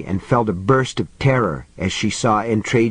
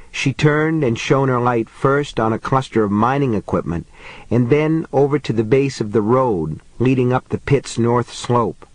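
ordinary delivery

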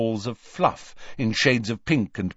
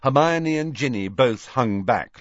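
plain reading voice

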